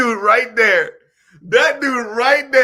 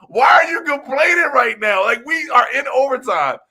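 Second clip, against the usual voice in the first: higher, louder scolding question; irritated outburst; 7 semitones higher, 5 dB louder than usual. Retold or imitated. Telling off his complaining friend, irritated shout. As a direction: snap at him to stop whining.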